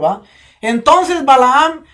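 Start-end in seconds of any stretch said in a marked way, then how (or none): none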